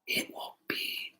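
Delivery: very soft whisper, slowing right down; hushed, drowsy reassurance trailing into slowness; sleepy whisper. A narrator's reading. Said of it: "whispered a sleepy little fox".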